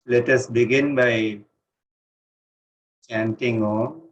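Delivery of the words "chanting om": ordinary talk, nothing marked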